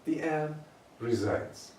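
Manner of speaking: ordinary talk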